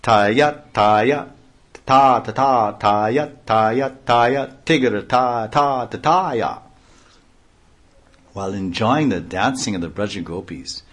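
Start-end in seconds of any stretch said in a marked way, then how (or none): 0.0-6.6 bold, louder rhythmic syllables; crisp, bold recitation of bols; bold uttering. Acted. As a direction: call out each beat with confidence. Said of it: "boldly utters".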